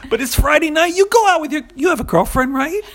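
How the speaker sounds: way up high and loud, hammed-up pushy voice; mock Italian tough guy. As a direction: lay down the law, then wink about Friday night. Voicing the Italian guy again.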